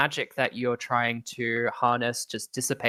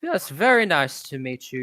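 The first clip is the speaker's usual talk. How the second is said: ordinary talk, nothing marked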